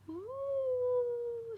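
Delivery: high, spooky ghost moan; ghost's moan. A narrator's reading. Ghost drifting into the house, high spooky moan. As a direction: float in the ghost's high eerie ooh. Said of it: "said the ghost as it floated in".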